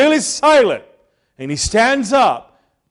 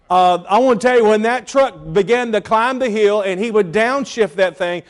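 ordinary talk

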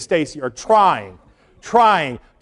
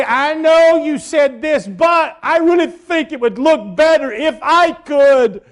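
high, slow condescending suggestion; mock a smug improver; mock know-it-all; 8 semitones higher, 3 dB louder, slower than usual. Mocking churchgoer's suggestion.

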